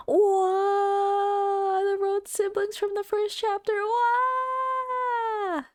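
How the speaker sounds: long high "whoa" of wonder; amazed excitement. Real, not acted. Excited reaction to game reveal.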